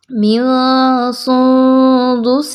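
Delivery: من سندس slow, noon hidden and nasal; ikhfa on noon. Shown as a demonstration. Teacher showing ikhfa, slow nasal recitation. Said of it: "نون ساکن کے بعد سین اخفا کریں گے".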